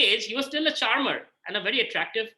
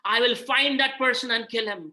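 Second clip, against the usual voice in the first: slow, raised roar of outrage; slow, higher-pitched vow of vengeance; angry Balaram; 4 semitones higher, 4 dB louder, slower than usual. Acted. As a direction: thunder out the threat, drawing the words out. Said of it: "Balaram gets very angry".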